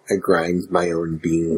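low and slow, mock serious; deep slow voice. Joke line in a put-on voice.

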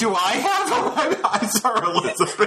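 high-pitched words broken by laughter; laughing. Real, not acted. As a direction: crack up while talking. Listener laughing at the twist.

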